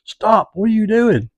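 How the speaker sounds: high, panicked mock yell; retold yelling. Guys in the car yelling at the driver, high retold yell. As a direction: imitate passengers yelling at the driver. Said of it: "the other guys were yelling in the car".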